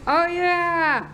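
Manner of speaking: high, drawn-out delighted "oh yeah"; thrilled to match; excited oh yeah. Real, not acted.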